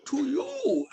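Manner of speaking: high, drawn-out villain flourish; Bane. Acted. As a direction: finish the villain quote with theatrical lift. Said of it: "he like".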